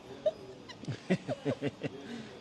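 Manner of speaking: real high burst of laughter; laughing. Real, not acted. Host amused by friend marrying at fifty, real laugh. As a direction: break into an open laugh at the joke.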